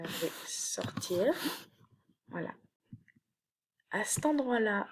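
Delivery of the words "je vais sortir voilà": whispered aside. Real, not acted.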